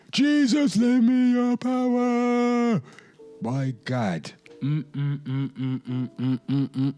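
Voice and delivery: ordinary talk, nothing marked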